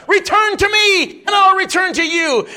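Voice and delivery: high, loud, slow cry; booming divine shout; God's call shouted. Acted. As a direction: shout God's answer over them.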